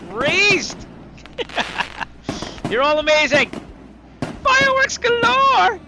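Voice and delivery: high, loud, stretched cheer; jubilant shout. Real, not acted. Streamer celebrating with viewers, loud and high. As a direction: cry out in celebration. Celebrating reaching the donation total.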